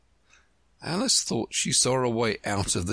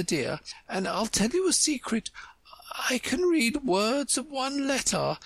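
high-pitched, encouraging queen. Acted. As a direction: share a secret in a much higher queenly voice.

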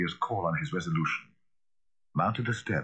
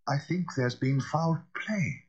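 hoarse; 8 semitones higher, slower than usual. A narrator's reading. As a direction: rasp it out slowly. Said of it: "said Poole hoarsely".

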